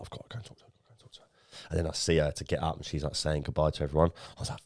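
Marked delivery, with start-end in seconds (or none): 0.0-1.3 whispering to himself, pumping himself up; breathy self-talk under his breath; whispered inner voice. Retold or imitated. Lad in the pub whispering to himself, eyeing a girl. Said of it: "And I was like".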